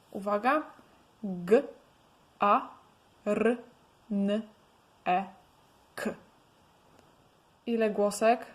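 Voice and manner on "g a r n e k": G, A, R, N, E, K spoken one by one with pauses; sounding out letters. Shown as a demonstration. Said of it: "ile tam jest głosek?". (głoska po głosce, powoli)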